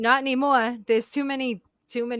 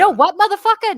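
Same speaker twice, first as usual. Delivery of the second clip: loud, high friendly swagger; jovial Boston bluster; 10 semitones higher, 9 dB louder than usual. Retold or imitated. Boston local answering insult, hearty loud bravado. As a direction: bark it as a greeting. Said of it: "We'd be like".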